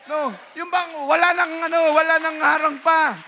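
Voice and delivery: loud, high pulpit voice; fiery preaching. Acted. Preacher rousing the brethren, loud and high. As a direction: drive the point home to the congregation.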